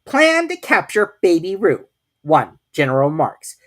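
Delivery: high, loud official Rabbit; pompous rabbit reads aloud; Rabbit reading. A narrator's reading. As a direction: read the plan pompously. Rabbit reads his plan.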